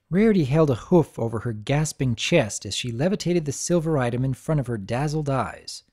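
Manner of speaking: ordinary delivery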